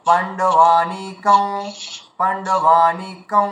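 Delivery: পাণ্ডবানিকং said very slowly, ending in a nasal ং; teacher drawing out পাণ্ডবানিকং; slow demonstration. Shown as a demonstration. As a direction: spell out পাণ্ডবানিকং syllable by syllable. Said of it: "এমন উচ্চারণ করা যায়".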